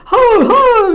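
very high, loud hearty ho ho; triumphant pirate cheer; pirate laugh. Acted. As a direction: boom out a gleeful pirate laugh. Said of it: "laughed Ebony".

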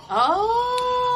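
high, loud drawn-out oh; thrilled realisation; excited. Real, not acted.

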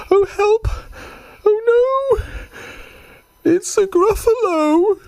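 very high squeak of alarm; panicked mouse squeal. Acted. Mouse discovers the Gruffalo is real, panicked squeal.

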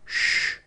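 long voiceless shush; drawn-out "shh" for silence; hushing shh. A narrator's reading. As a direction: hush everyone urgently. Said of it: "said the Little Corporal, holding up his hand".

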